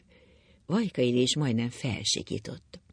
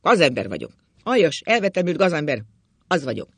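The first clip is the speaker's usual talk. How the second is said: loud, emphatic self-condemnation; angry bellowing; 2 semitones higher, 7 dB louder than usual. A narrator's reading. Drunk husband silencing his wife and cursing himself, bellowing. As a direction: hammer out each accusation. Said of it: "rivolt rá az öreg".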